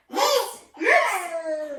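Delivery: high, loud, laughing call; playful calling out. Pretend. Teacher moving her toddler on to the next pose, high playful call.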